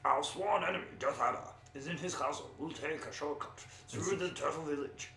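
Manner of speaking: put-on storybook dwarf; game-quest dwarf character voice. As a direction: read it out as a fantasy dwarf. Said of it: "is that your dwarf voice?".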